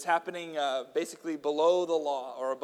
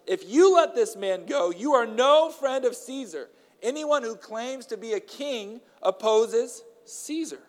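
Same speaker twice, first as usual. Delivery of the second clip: louder, higher, slower shout; 7 semitones higher, 5 dB louder, slower than usual. A narrator's reading. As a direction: leaders pressing Pilate. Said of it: "kept shouting".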